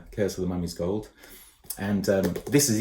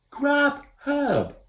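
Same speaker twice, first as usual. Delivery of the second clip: high, slow, loud call; shouting; 5 dB louder than usual. A narrator's reading. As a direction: call up from far below. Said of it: "Koo shouted".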